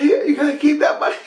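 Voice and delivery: put-on fast, high, laughing kid voice; kids' voice. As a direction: chirp it quickly like giggling girls. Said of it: "They're like".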